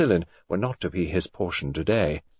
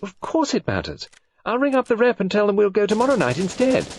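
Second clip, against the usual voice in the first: higher, louder, pleading to fix it; eager insistence; 14 semitones higher, 4 dB louder than usual. Acted. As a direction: rush to make amends. Contrite son insists eagerly.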